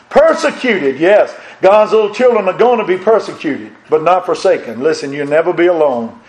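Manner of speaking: usual speaking voice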